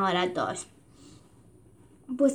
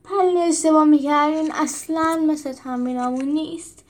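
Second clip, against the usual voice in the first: exhausted wail; 6 semitones higher than usual. Acted. Cornelius wailing to the others mid-battle, tired whine. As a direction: whimper like he can't hold on. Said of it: "با خستگی زار زد".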